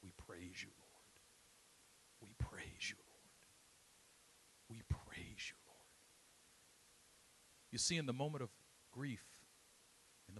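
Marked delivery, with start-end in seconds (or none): 0.0-5.8 hushed whispered prayer, fading away; whispered praise. Retold or imitated. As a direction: breathe it out through grief. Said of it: "this is what he cried out".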